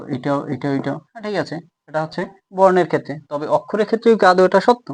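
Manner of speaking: ordinary talk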